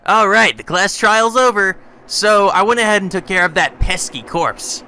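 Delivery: high, loud, gleeful cartoon bear; chirpy sadistic mascot, drawn-out and loud; Monokuma voice. Player voicing Monokuma to the students, high gleeful bear. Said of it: "Monokuma said to us".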